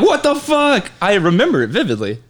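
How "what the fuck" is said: high-pitched retold disbelief; acted freak-out. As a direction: imitate freaking out, pitch jumping up. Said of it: "I'd be freaking out".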